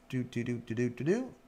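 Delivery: ordinary talk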